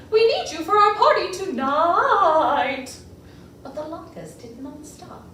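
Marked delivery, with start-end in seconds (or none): 0.1-2.9 slow, high sweet singsong. Acted. The cantor coaxing the latkes back in a sweet voice. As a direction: coax them sweetly. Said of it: "she trilled in a sweet voice".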